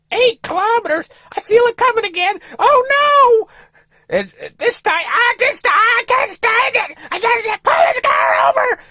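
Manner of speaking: very high, loud, crying wife voice; frantic shouting wife. Retold or imitated. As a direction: scream at him to pull over. Wife in bowel emergency screaming.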